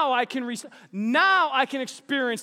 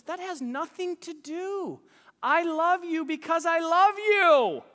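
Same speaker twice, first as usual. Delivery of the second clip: insistent wife; 8 semitones higher, 3 dB louder, slower than usual. Retold or imitated. Quoted wife, building insistence.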